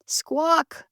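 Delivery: very high squawk; hen squawk. A narrator's reading. Hen squawking at the duckling, sharp and high. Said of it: "said the hen".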